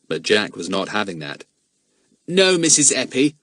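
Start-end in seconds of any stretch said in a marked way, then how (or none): 2.3-3.4 politely firm refusal, pitched higher; firm but polite. A narrator's reading. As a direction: turn her down firmly yet courteously. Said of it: "he said firmly but politely".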